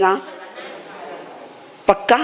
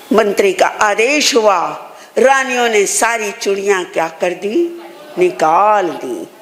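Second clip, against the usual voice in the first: ordinary delivery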